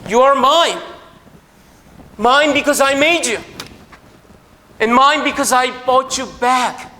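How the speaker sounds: preacher proclaims God's words, loud and slow; proclaiming loudly. Acted. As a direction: call it out as God's claim on us. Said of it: "이 비유의 핵심은 바로 저 이야기".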